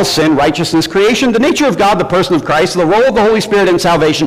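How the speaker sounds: usual speaking voice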